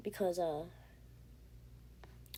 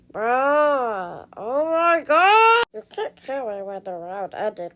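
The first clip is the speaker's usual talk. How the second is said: loud, high outraged wail at being killed; outraged cry; 3 semitones higher, 9 dB louder, slower than usual. Real, not acted. Yelling at the player who killed them after the round, outraged. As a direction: cry out in protest, stretched and shrill-free. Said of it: "Are you dumb?".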